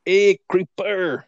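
high, drawn-out mock Latin call; Latin accent. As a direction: savour the name slowly in a put-on Latin accent. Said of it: "Say it. That's fun to say".

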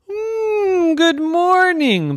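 high, drawn-out morning greeting; waking-up girl. Acted. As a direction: stretch into a high, slow wake-up hum. Waking up in the story.

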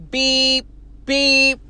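short, level electronic-style beeps; imitated detector beep. Man walks into metal detector, imitated beeps. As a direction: mimic a metal detector going off.

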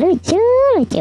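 squeaky high voice on "lucu"; high pitch effect. Shown as a demonstration. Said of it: "jenaka-jenaka sekali".

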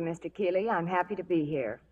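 normal talking voice

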